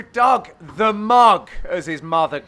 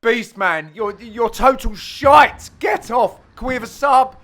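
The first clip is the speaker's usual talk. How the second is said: loud, jeering call to the ref; mock demand for a sub; 4 semitones higher, 6 dB louder than usual. Heckling the opponent mid-match, loud mock call for a sub. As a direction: shout him off the court like a fed-up teammate. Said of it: "we go".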